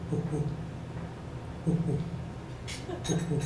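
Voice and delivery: low, soft, spaced hoots; imitated bear huffing. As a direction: huff softly in short pairs. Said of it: "over from my left, I heard".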